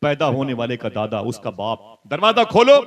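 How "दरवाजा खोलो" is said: loud call at the door. Retold or imitated. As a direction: shout to open the door, high and loud. Calling at the door. (दरवाजा खोलो ऊँची आवाज़ में पुकार)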